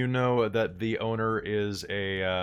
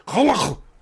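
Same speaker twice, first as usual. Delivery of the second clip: laugh; 11 dB louder than usual. Real, not acted. Laughing at her Gaelic name.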